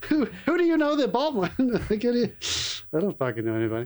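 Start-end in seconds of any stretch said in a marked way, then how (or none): 0.1-2.3 high, fast, laughing question; incredulous laugh while asking. Real, not acted. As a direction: ask it through laughter, amazed. Laughing disbelief at ticket price.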